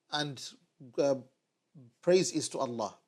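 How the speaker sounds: normal talking voice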